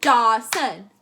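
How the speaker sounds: Dawson in two loud beats; clapped-out syllables. Shown as a demonstration. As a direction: split Dawson as if clapping each half. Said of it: "let's do it clapping".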